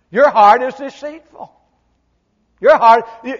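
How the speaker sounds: ordinary delivery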